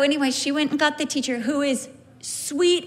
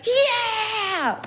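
put-on excited child; 11 semitones higher, slower than usual. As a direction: shout it like a thrilled five-year-old. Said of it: "he'd be like".

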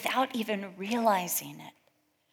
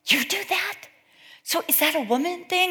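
surprised; 10 semitones higher than usual. Retold or imitated. Women comparing habits, surprised.

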